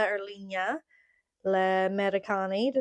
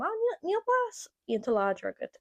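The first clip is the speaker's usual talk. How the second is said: much higher-pitched quoted remark; quoting a listener; 11 semitones higher, faster than usual. Retold or imitated. Retelling a listener's comment on accent, high quoting voice. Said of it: "dúirt sé lam".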